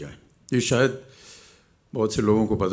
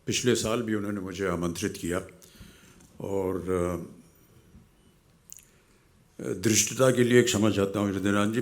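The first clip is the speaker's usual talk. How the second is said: normal talking voice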